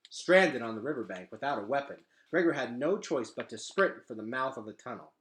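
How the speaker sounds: usual speaking voice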